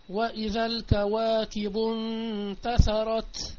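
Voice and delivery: slow intoned verse; recited verse, slow and measured; Quran recitation. Acted. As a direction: chant the verse before explaining it. Recites verse in tafsir lesson.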